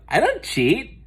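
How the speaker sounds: high, innocent fib; sugary lying schoolgirl; smug denial. Acted. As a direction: lie sweetly with a smile. Muffy lies, smiling.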